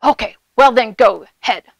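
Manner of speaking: troll. A narrator's reading. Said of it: "said the troll".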